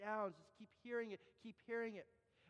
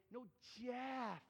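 Jeff drawn out in correction; patient, emphatic long Jeff; insistent correction; 4 semitones higher than usual. Retold or imitated. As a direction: stretch JEFF to correct the listener. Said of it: "And I say".

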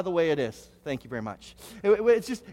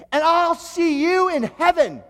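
loud, slow, high parting shot with an edge; sting the blessing with an edge; pointed farewell; 6 semitones higher, 7 dB louder, slower than usual. Retold or imitated. Pastor's snide parting line to the woman. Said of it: "it wasn't a blessing".

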